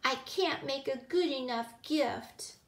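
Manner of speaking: put-on high-pitched childlike lament; wailing. A narrator's reading. Gilly failing to make a gift, high wailing. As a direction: keep up the little girl's wail. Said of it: "Gilly wailed".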